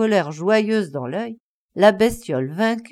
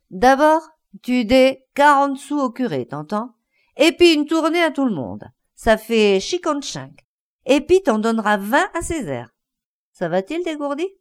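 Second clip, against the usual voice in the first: booming orders; 4 semitones higher, 3 dB louder than usual. Acted. Caniveau settles the quarrel.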